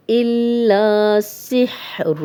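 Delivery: slow, drawn-out melodic recitation; slow tajweed recitation; Quranic recitation. Shown as a demonstration. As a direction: chant the verse like a reciter. Recitation of the verse.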